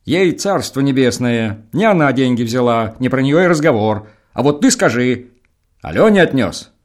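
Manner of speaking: the elder pushing the son to answer; pressing elder. Acted. Староста наседает на сына. (выше и громче, требовательно)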